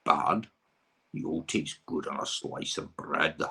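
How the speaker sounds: lightly voiced Scottish storybook monster, slowing; slow, savouring attempt at a Scottish monster; Robbie Coltrane Gruffalo. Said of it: "that was my Robbie Coltrane doing the Gruffalo".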